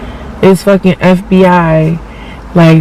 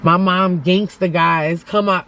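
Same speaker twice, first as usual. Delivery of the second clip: ordinary talk, nothing marked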